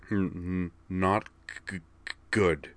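stammered answer, anger held in; tight with anger. A narrator's reading. Kate answering a friend after leaving the office, tight stammering anger. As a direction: stutter on the first sounds, tightly. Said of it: "her voice still tight with anger".

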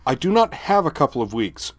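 snapped, nearly shouting. Acted. Laura cutting Griffin off, snapping, nearly shouting. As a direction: bite it off, almost yelling. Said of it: "Laura snapped, nearly shouting".

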